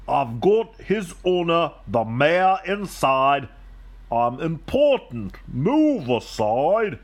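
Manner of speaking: lightly voiced pompous limo, drawn out at the end; self-important limousine. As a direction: play a limo that feels important.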